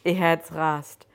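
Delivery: plain reading voice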